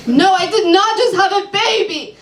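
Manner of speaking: high, offended correction; annoyed client. Acted. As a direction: correct her sharply.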